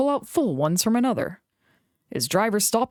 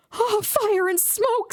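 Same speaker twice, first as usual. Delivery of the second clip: dismayed engine; 14 semitones higher, slower than usual. A narrator's reading. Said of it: "said Thomas".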